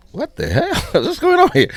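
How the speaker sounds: high, loud comic disbelief; loud retold shock; astonished outburst. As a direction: exclaim in stunned amazement, half laughing. Retelling his astonished arrival.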